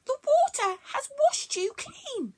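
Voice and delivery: wondering creature; amazed. Acted. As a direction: marvel at her. Bronglae amazed.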